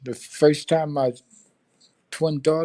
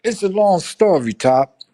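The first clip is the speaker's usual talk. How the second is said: louder, higher sheepish dodge; sheepish excuse; 6 semitones higher, 9 dB louder than usual. Retold or imitated. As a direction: brush it off loudly to the sergeant. Excuse to the first sergeant.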